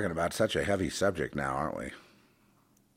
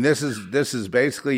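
normal talking voice

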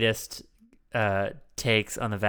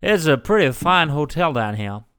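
hammed-up South Carolina accent; mock genteel Southern accent; 5 semitones higher, 7 dB louder than usual. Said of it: "it's more of a Virginia, Georgia, sort of South Carolina".

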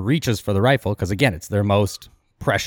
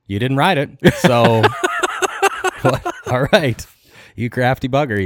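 higher, amused, breaking into laughter; retold laughing concession; 4 semitones higher, 4 dB louder than usual. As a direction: imitate an amused guard giving in. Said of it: "the guard is just kinda laughing".

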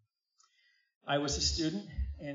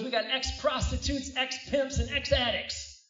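preacher quote; 11 semitones higher, 6 dB louder, slower than usual. Retold or imitated. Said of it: "He goes".

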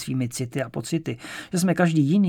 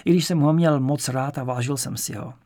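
usual speaking voice

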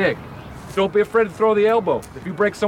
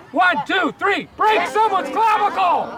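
harsh barking commands; 6 semitones higher, slower than usual. Acted. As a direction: bellow it like a war cry. Team chant, coach shouting.

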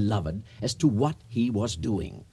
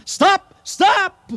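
much higher and louder shout of Stop; Santa Claus shouting; 7 dB louder than usual. Acted. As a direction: yell after the fleeing van. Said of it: "as Santa Claus shouted".

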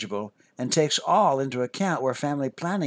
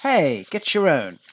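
slow, loud, indignant protest; 3 semitones higher, 5 dB louder than usual. Acted. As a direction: push back, get your own.

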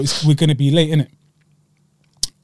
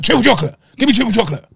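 greedy demand; 6 semitones higher, faster than usual. Retold or imitated. Voicing friend's greedy demand.

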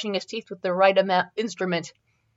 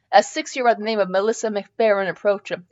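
ordinary delivery, nothing marked

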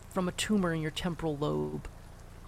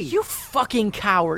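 angry; 6 dB louder, slower than usual. Acted. Son confronting father who left.

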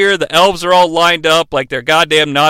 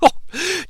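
quick high leering giggle; lecherous giggle; 10 semitones higher, 6 dB quieter than usual. Retold or imitated. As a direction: giggle like a dirty old man. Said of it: "grin on his face like".

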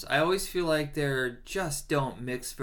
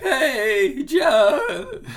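squeaky, much higher cracking call, laughing; exaggerated voice crack; 14 semitones higher, 9 dB louder than usual. Shown as a demonstration. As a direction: overdo the crack on "John" for a laugh. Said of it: "Did you hear my voice crack?".

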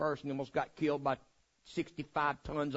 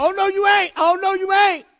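high, loud stern refusal; scolding mama; 13 dB louder than usual. Retold or imitated. Mama refusing muddy son entry, shrill scold. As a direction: stop him cold at the door. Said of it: "She come met me at the door".

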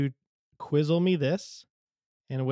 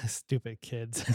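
ordinary talk, nothing marked

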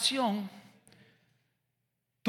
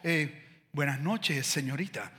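put-on low, stiff old-fashioned greeting; stiff formal greeting; 5 semitones lower, 4 dB quieter, slower than usual. Shy suitor greeting the girl stiffly. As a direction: play an awkward overly formal suitor. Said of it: "Este man está ridículo".

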